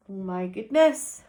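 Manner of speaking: usual speaking voice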